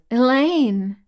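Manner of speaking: high, loud wail of a name. A narrator's reading. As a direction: cry out for a rescuer in distress. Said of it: "Doro wailed".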